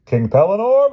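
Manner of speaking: high, loud bright reply; cheerful princess. Acted. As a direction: answer gladly. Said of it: "replied the princess cheerfully".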